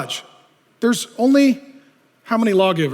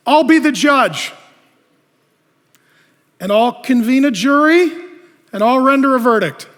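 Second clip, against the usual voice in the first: proud self-appointed judge; 6 semitones higher, 7 dB louder than usual. Acted. Preacher mocking a proud judge, loud and pompous. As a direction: puff yourself up as the judge. Prideful person playing judge.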